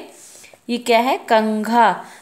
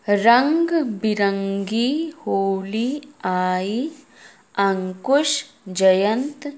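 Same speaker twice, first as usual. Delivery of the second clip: slow read-aloud; slow model reading; much slower than usual. Shown as a demonstration. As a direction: read slowly, word by word. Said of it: "आपको अच्छे से पढ़ना है।".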